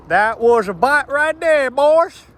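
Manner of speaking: the same line again, high and loud, in a Southern twang; Southern drawl. Real, not acted. Southern drawl as he cheers a fish bite. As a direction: repeat it as a Southern angler. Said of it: "I just turned southern all of a sudden".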